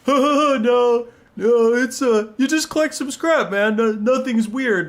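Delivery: high, phony innocent chuckle-talk; teasing friends. Retold or imitated. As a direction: imitate friends lying with a mocking laugh. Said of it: "My friends, being shitters, are like".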